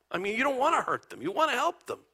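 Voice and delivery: ordinary talk, nothing marked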